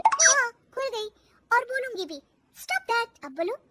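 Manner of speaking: high, bold back-talk; cheeky high-voiced defiance; defiant retort. Daughter-in-law defiantly talks back.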